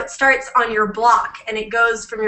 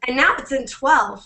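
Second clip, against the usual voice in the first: normal talking voice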